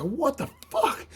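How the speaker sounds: slow, high, hushed disbelief; disbelieving. Retold or imitated. Recalling his reaction to the ad, with slow hushed disbelief. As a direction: let it drop out in shock.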